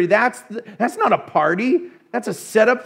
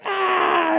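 high startled yelp; retold shocked cry; 11 semitones higher than usual. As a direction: cry out at the sight. Moses sees the golden calf.

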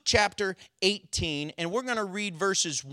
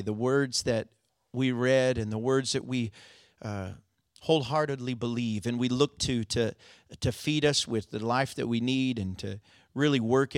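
prayer; 7 semitones lower than usual. Acted. Pastor leads closing prayer.

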